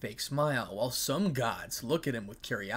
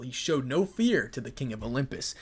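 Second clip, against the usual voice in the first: usual speaking voice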